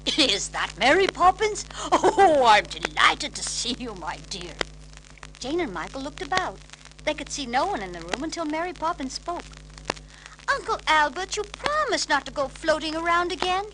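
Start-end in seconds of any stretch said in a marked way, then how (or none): 0.1-4.6 gurgle it out full of chuckles; chuckling old uncle. A narrator's reading. Uncle Albert greeting his niece, gurgling with chuckles. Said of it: "gurgled a voice rich with chuckles". 10.5-13.7 high, loud prim reproach; scolding nanny. A narrator's reading. Mary Poppins scolding her floating uncle, prim and high. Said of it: "she said".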